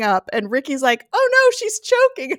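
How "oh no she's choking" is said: high, loud imitation of alarm; hammed-up panicked cry for help; mock panic. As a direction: shriek it like an over-reacting friend. Retold panicked cry, acted loudly.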